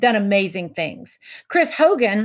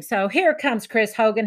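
ordinary talk

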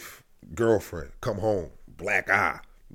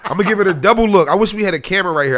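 rushed, louder and quick; fast excited telling; 2 semitones higher, 5 dB louder, faster than usual. Real, not acted. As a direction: hurry through it excitedly.

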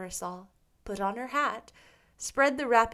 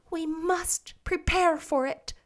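put-on slow, composed girlish voice; calm her friend slowly and evenly; tranquil; 7 semitones higher, 3 dB louder than usual. A narrator's reading. Anne calmly reassuring frightened Diana, slow and composed. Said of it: "said Anne tranquilly".

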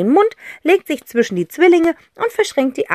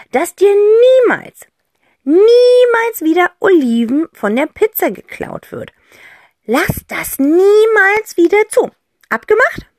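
solemn aunt; 12 semitones higher, 7 dB louder than usual. Acted. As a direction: swear him to it with mock gravity. Aunt makes a promise. (Tante Rotz feierlich beschwörend)

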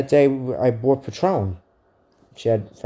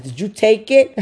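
higher, louder accusation; accusing yell; 9 semitones higher, 6 dB louder than usual. Retold or imitated. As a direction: demand it like a suspicious drunk. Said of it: "I said".

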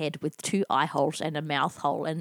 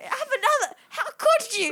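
high, hurt mock wailing; betrayed spouse. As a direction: play the wronged wife.

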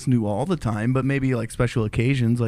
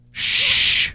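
a loud unvoiced shush; 4 dB louder than usual. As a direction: hush everyone sharply. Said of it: "Why are you shushing".

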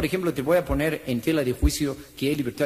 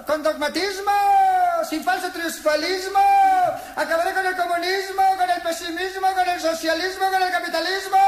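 ranting politician; 13 semitones higher, 3 dB louder than usual. Retold or imitated. Politician on a platform shouting slogans at the crowd, high rally ranting. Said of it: "estaba bien metido en su plataforma gritando".

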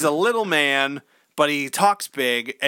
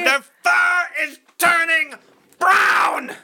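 much higher, louder crying yell; mock hysterical shout; 14 semitones higher, 4 dB louder, slower than usual. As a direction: scream the doom in ham panic. Parody vision, shouting.

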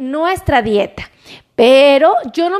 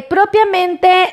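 usual speaking voice

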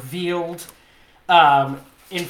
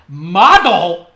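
"model" repeated much louder, drawn out; stress on model; 11 dB louder than usual. Real, not acted. Storyteller to co-host about runway class, stress on model. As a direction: punch "model" hard, far louder.

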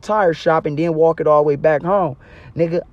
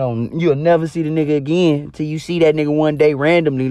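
ordinary delivery

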